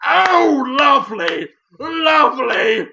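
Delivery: hammed-up delighted cry, stretched slow; a put-on gasp of delight; delighted Patrick Stewart. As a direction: gush over it like a proud head teacher. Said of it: "Patrick Stewart ... is just".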